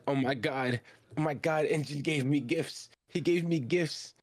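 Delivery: fanboy gushing. Retold or imitated. Fan gushing over engine's gifts.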